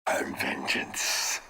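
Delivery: low, slow whispered menace; whispered villain line. Retold or imitated. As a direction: breathe it out like a brooding vigilante. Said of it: "we found our new Hush".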